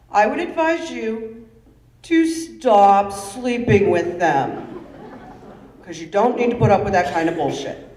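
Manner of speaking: loud, emphatic dad laying down rules; dad's loud, deliberate instructions; lightly voiced father. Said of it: "And he told me this".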